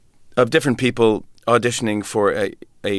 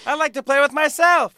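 Pee Wee Herman. Acted. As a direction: squeal it in a silly put-on voice with a goofy laugh. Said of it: "I was doing Pee Wee Herman".

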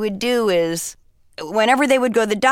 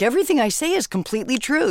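mock boastful claim; 7 semitones higher, much faster than usual. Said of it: "I don't even find that appealing to say".

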